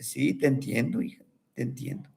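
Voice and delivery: soft low grandfather's reassurance; soothing grandfather. Acted. Grandfather comforting upset granddaughter, soft and soothing. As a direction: hush her gently like a kind old man.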